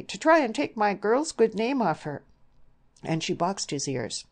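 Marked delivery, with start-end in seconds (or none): 0.0-2.2 loud, high-pitched rush of fury; furious outburst. A narrator's reading. Outraged mother scolding her daughter's suitor, furious. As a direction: lash out at the scoundrel. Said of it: "in a rush of fury".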